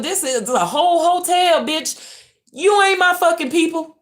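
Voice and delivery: high, loud furious shouting; furious rant. Real, not acted. Venting at the hotel workers who hate her channel, high furious shout. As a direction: rail at them at the top of your voice.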